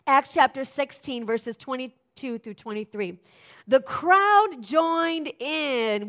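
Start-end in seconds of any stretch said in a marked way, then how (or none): 3.7-6.1 dramatic reading. Acted.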